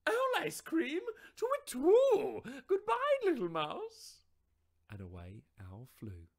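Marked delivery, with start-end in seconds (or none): 0.1-4.2 very high, loud, hooting owl in alarm; panicked owl. Acted. Owl fleeing the mouse with a frightened hoot.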